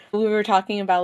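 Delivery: ordinary talk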